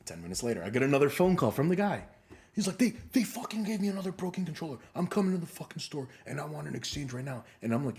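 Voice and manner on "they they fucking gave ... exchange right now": imitated angry customer. Customer phoning the store about another broken controller, angry. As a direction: act out a worked-up caller demanding action. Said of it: "I don't care how angry you are".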